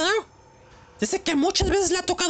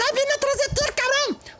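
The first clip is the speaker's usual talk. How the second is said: mock panic; 7 semitones higher than usual. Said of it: "los güeyes".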